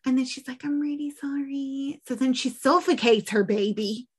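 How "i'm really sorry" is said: small, higher, apologetic voice; soft mock apology. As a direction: softly imitate a sorry mother. Mother apologising before killing her baby.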